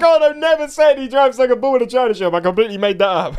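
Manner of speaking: high, rapid, excited crowing; gleeful reveal. Real, not acted. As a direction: rush out the reveal in triumph. Crows over fooling the opponent.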